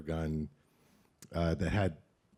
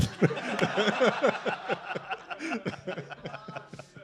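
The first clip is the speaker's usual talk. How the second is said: a long, high laugh of refusal. Real, not acted. Refusing the host's request to do the voice, long laugh.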